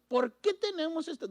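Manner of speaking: high, whiny why-us question; mock whiny protest the believer should not make; mock complaint. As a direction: sound aggrieved at God, the wrong way. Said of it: "no le digas".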